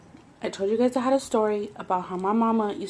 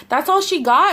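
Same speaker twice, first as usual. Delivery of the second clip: higher, louder mock cashier; mimicking cashier; 7 semitones higher, 6 dB louder than usual. Voicing the cashier doubting the order, higher. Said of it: "the girl's like".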